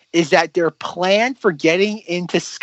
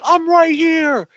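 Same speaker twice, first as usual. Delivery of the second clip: high, drawn-out calling out; mock taunting call; 11 semitones higher, 4 dB louder, slower than usual. Podcaster mocking a too-obvious movie moment, hammed-up calling out. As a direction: call out slowly as if inviting attack.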